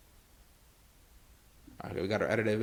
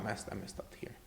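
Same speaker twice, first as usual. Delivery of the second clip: whispered mutter; 4 semitones lower, 16 dB quieter than usual. Real, not acted. Muttering a mistake to himself.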